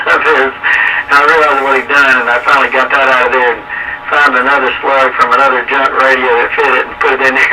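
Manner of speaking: ordinary talk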